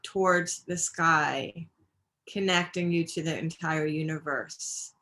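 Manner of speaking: unhurried yoga-teacher meditation voice, phrase by phrase; even, measured breathing-practice guidance with long pauses; guided meditation. Real, not acted. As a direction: guide them gently through the breath, pausing between phrases.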